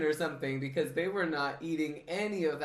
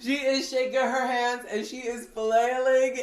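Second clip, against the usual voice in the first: high, loud, laughing telling; cracking up while retelling; laughing through it; 7 semitones higher, 8 dB louder than usual. Real, not acted. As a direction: giggle through the story.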